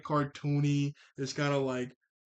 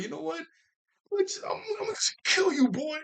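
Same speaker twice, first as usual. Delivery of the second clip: mock menacing leader; 14 semitones higher than usual. Leader threatening the boy, hammed-up high voice. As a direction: ham up a leader about to kill.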